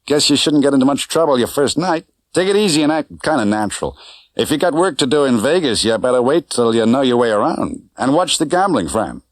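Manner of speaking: a hint of a breezy Vegas cabbie; driver Correa. A narrator's reading. Driver Correa gives advice.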